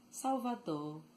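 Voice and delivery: "Salvador" ending on an open o, no R; dropped final R. Shown as a demonstration. Teacher showing Bahian speech, "Salvador" with final R dropped. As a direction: leave the R off the end of "Salvador". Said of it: "leave the R out a little bit".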